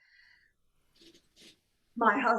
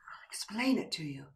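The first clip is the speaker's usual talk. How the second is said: fast breathless whisper; 3 semitones lower, 4 dB quieter, faster than usual. Acted. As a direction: lean in and breathe it. Whispers to him on her knees.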